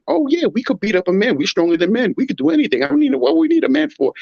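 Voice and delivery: high, mocking voice of a cocky woman; mock a boastful woman, pitch rising; mocking women. Man mocking women who say they need no man, high and mocking. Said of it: "females and things are thinking".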